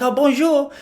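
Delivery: put-on high-pitched little French kid's accent; playful French-accented greeting. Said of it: "if a little French kid".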